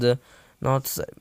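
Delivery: ordinary delivery, nothing marked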